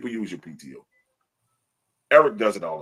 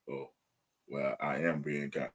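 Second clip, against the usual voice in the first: softer, lower imitated reflection; quiet self-justification; 7 semitones lower, 11 dB quieter than usual. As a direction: mull it over under your voice. Imitating someone reconsidering themselves.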